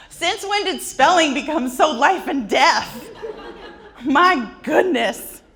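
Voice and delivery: high, loud, slow disbelief; mock exasperation. Real, not acted. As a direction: throw up your hands at the absurdity. Mock outrage about spelling.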